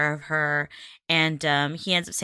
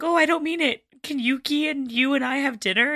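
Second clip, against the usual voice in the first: high mock pleading; 7 semitones higher than usual. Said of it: "he's like".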